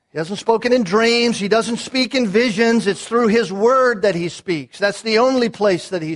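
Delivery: full-voiced sermon climax; preaching at full force. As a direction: thunder it from the pulpit, building. Preacher insisting on the word.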